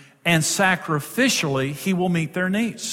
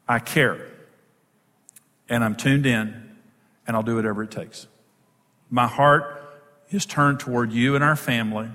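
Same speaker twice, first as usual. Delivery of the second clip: calm, caring; 6 semitones lower than usual. Acted. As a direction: speak low and unhurried, pledging devotion to a wife. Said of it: "with that tone in my voice".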